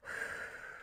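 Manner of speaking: slow breath out to settle the nerves; deep unvoiced exhale to calm down; long calming sigh. Real, not acted. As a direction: breathe out the panic. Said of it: "Take a deep breath".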